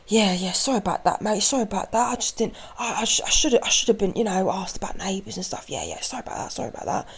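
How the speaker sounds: man apologising to the neighbour; muttered apology. Retold or imitated. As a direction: mumble sorry like a scolded man.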